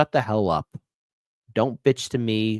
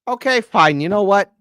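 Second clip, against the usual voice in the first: higher and louder, fed up; exasperated outburst; 8 semitones higher, 8 dB louder than usual. Real, not acted. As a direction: throw up your hands out loud.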